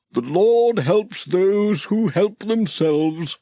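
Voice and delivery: a kindly country vicar intoning a proverb; Reverend Timms. Acted. Vicar character voice.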